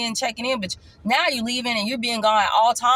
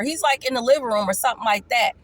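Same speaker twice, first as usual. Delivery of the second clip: ordinary talk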